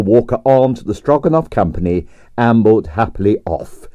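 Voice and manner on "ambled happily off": ordinary delivery, nothing marked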